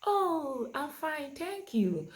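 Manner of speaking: put-on high, lively child's reply; child answering. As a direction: answer as a chatty child, pitched well up. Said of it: "the child will look at it and say".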